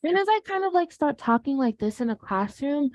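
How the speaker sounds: high, light classroom voice. Shown as a demonstration. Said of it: "talking to you like this".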